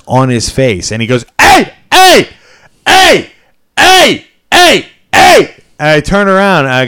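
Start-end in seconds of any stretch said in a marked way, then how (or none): 1.4-5.5 high, insistent imitated shouts; calling out. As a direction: yell short repeated hails like a pestering stranger. Acting out a stranger hailing him.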